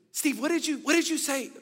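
high, loud alarmed questions; upset sister. Retold or imitated. Embarrassed sister demanding what her brother said, high and loud. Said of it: "my sister goes".

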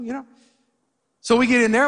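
normal talking voice

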